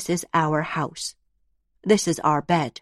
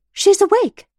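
high, loud excited exclaim; a thrilled kid shouting she is awake; excited child; 8 dB louder than usual. Acted. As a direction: burst out with the news.

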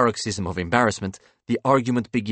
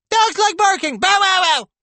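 put-on very high, loud squeaky boy interrupting; shouting child-dog; 9 dB louder than usual. A narrator's reading. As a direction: shriek it like a kid playing dog. Boy playing dog interrupts the adults.

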